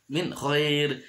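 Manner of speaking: "min khair" with a short crisp n; "min khair", no hum on the n; clear idhar reading. Shown as a demonstration. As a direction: cut "min" cleanly before "khair". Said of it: "harus jelas".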